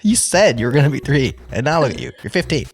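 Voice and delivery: put-on playful scolding of a child; mock scolding. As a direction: scold with fake outrage. Joking scolding of her child.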